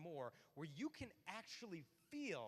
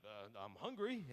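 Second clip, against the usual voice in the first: clueless man; 9 semitones lower, 4 dB louder, slower than usual. Acted. Acted clueless man answering.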